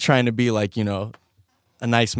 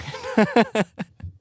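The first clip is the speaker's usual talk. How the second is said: high laugh; 11 semitones higher than usual. Real, not acted. Laughs at own moth story.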